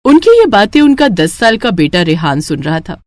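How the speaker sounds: usual speaking voice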